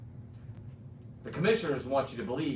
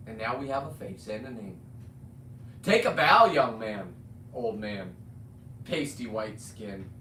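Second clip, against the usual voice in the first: usual speaking voice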